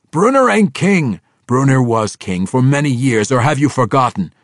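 high, loud gruff outburst; dwarf denying Bruner is king; angry dwarf. Acted. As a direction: shout him down. Dwarf retorts.

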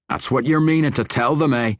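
the dwarf pins down the drow; suspicious dwarf. Acted. Bruenor questions Jarlaxle.

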